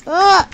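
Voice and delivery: sharp loud high yelp; startled yelp. Real, not acted. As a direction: jump at the scare.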